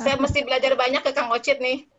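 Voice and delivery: light laugh through the words. Real, not acted. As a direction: chuckle while paying the compliment. Thanks colleague with a laugh.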